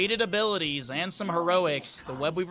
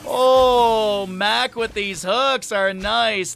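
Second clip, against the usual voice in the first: excited play call; 7 semitones higher, 8 dB louder, slower than usual. Real, not acted.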